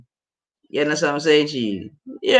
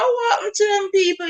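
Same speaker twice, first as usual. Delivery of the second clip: excited shout. Real, not acted. Excited reaction to the song.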